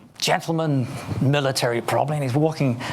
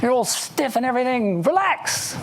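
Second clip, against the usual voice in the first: much higher, lightly voiced teasing coworker; teasing colleague; 6 semitones higher than usual. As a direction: rib him playfully in a raised voice. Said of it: "I said".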